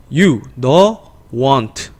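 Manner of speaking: normal talking voice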